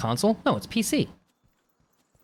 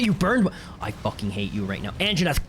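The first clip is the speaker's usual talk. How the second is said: ordinary delivery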